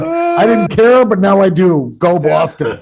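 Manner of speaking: mock fan conversion. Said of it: "I'm like".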